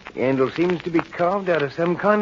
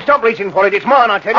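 raised, higher angry voice; possessive anger; 4 semitones higher, 5 dB louder, faster than usual. Acted. Henry fighting Albert for the knife, angry and possessive. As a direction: warn him off, loudly. Quarrel over who holds knife.

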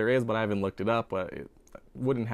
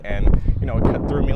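gusty wind noise over speech; wind on mic; 8 dB louder than usual. Real, not acted. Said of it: "and it's very windy".

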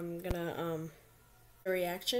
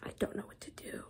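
whispering; 8 dB quieter than usual. Real, not acted. Whispering in doctor's bathroom.

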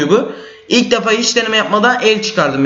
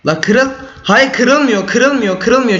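disbelieving wail; 6 semitones higher, much slower than usual. Real, not acted. Disbelief as block won't break.

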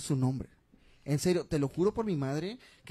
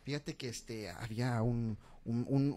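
ordinary talk

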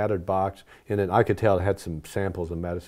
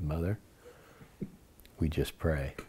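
soft knowing reply; 5 semitones lower, 9 dB quieter than usual. Retold or imitated. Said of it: "And I said".

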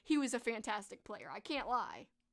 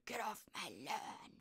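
quieter, slower low grumble; Clint Eastwood growl; 3 semitones lower, 8 dB quieter than usual. Retold or imitated. Correcting her Clint Eastwood impression for viewers, slow low growl. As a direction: mutter it like a grumpy old man. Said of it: "Okay, it's more like".

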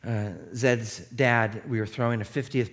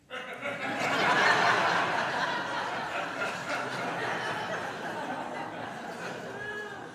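breathless laugh; 13 semitones higher, 7 dB quieter than usual. Real, not acted. Laughter in the story.

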